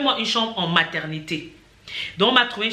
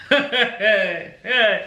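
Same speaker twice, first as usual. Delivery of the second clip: short laugh. Real, not acted. Laughs at missing news.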